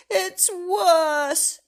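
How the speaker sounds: glum insistence. Acted. Mister Happy insists to Miss Magic, loud and gloomy. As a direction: insist it's worse.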